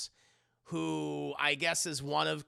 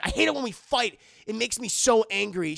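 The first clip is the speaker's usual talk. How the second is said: mock angry quote; 5 semitones higher, 7 dB louder than usual.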